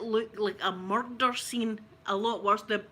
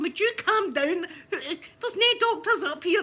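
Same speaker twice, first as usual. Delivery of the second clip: loud, slow, laughing calm-down; exasperated husband; 7 semitones higher, 5 dB louder than usual. Retold or imitated. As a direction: tell her to calm down through laughter. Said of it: "Mike's husband going".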